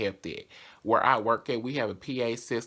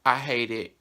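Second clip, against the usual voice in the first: normal talking voice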